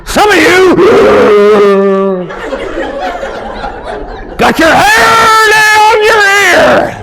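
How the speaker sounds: high, loud gasping mock preacher; asthma preacher. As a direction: wheeze through the sermon as if out of air. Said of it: "I call it the asthma preachers".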